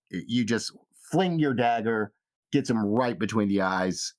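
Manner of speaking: normal talking voice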